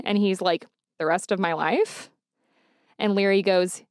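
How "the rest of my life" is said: retold incredulous question, rising sharply on LIFE; disbelieving echo. Grown son questioning his father's remark, in disbelief. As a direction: echo it back as a stunned question. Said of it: "looking at him like, "I cannot believe".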